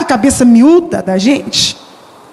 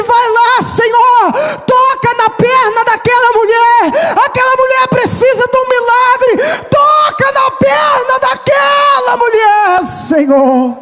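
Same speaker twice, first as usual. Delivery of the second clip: impassioned prayer; 10 semitones higher, slower than usual. Retold or imitated. Pastor praying aloud for the sick mother, fervent. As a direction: beg God for the miracle. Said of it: "E ele disse".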